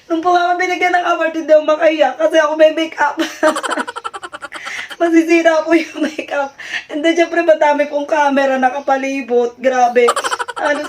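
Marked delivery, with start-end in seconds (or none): 3.2-4.9 soft laugh. Real, not acted. Laughing about her makeup.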